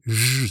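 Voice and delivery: "zh" as a held buzz, like the middle of "vision"; zh sound. Shown as a demonstration. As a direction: draw out "zh" as heard in "closure". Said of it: "represents the sound you hear in words like vision".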